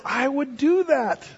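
gently voiced loving God; tender God. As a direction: say it like a mother to her child. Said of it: "God says".

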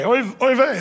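a hint of a Yiddish "oy vey"; Yiddish exclamation. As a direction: lift it into a mild Yiddish outcry. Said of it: "That's how they say it".

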